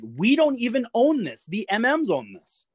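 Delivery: high-pitched retold objection, falling at the end; exasperated protest. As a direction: protest your innocence, pitch jumping up. Protests blame while being screamed at.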